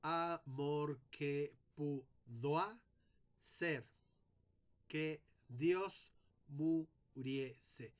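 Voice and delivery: very slow, pausing between syllables; scanning the verse. Shown as a demonstration. Teacher measuring the verse for students, very slow. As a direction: stretch the line out to hear each syllable. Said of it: "los versos se miden por sonidos".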